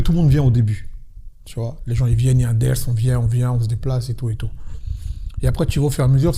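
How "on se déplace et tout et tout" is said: ordinary talk, nothing marked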